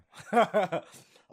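loud high laugh; sudden real laugh; burst of laughter. Real, not acted. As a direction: laugh it off, a bit embarrassed. Laughs at her own ranting.

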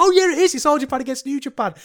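very high, loud, carried-away reaction; enthused realisation. Retold or imitated. Viewer catching on along with the commentators, enthused. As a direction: re-enact getting swept up, pitched right up and quick. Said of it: "I was enthused along with them".

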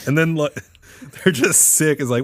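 ordinary delivery

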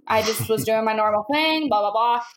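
usual speaking voice

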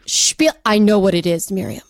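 ordinary talk

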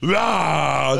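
hammed-up monster yell; imitated haunted-house roar. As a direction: bellow a long scare-actor "bah". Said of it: "he sounds Russian still".

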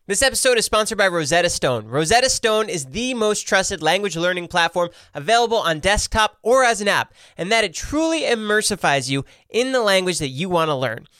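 energetic podcast ad read; sponsor read. Real, not acted. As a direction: sell it with lively, punchy emphasis. Reads a sponsor ad.

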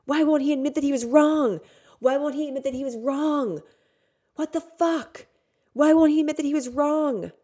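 high, loud, desperate repeated cry; anguished shout. Retold or imitated. Inner child screams at her absent father. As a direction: wail it out like a hurt child again and again.

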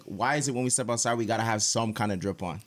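usual speaking voice

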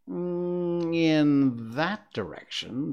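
slow, teasing cat voice; amused cat, taking its time; snooty bored cat. Acted. The Cheshire Cat voiced in story.

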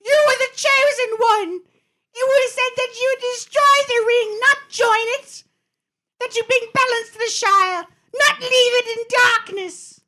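high, loud, despairing yell; anguished shout. Acted. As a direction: scream it at a fallen friend. Obi-Wan shouting at fallen Anakin.

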